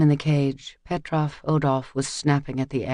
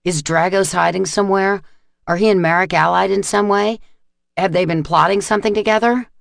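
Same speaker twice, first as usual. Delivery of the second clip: higher, louder, pressing questions; angry demand; 4 semitones higher, 4 dB louder than usual. Acted. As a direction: interrogate him hard, getting faster. Tegan demanding answers at cage.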